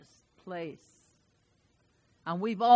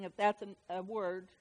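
ordinary talk, nothing marked

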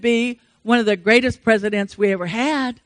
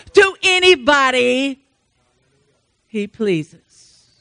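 slow, rising, louder on each pleases; building sermon cadence; 4 semitones higher, 3 dB louder, slower than usual. Acted. Preacher urging church not to lose hope, building. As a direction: build it phrase by phrase to a peak.

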